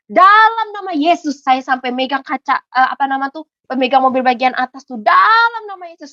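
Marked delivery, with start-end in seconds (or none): 0.1-1.5 high, loud, drawn-out shout; retold desperate cry. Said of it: "saya langsung teriak". 5.0-6.1 retold desperate cry. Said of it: "saya teriak luar biasa".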